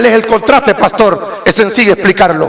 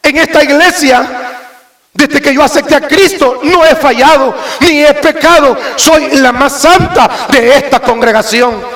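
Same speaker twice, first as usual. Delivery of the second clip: mock self-righteous sister; 6 semitones higher than usual. A member boasting to the church that she never sinned, mock sanctimonious and higher. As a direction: play the holier-than-thou sister, nose in the air. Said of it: "aparece otra hermana ahí y dice".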